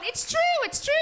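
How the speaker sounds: very high-pitched, squeaky agreement; imitated agreeing audience. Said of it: "you're clapping 'cause you're going".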